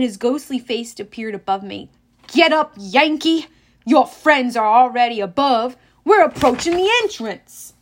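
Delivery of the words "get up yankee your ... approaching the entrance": gravelly captain. A narrator's reading. Captain calling all hands on deck, loud. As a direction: rouse the whole crew. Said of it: "captain's gravelly voice".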